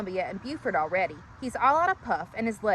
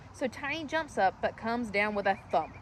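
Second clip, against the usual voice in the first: ordinary delivery, nothing marked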